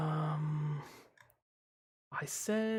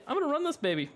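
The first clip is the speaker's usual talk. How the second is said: excited; 9 semitones higher, much faster than usual. Real, not acted. Hyping himself up.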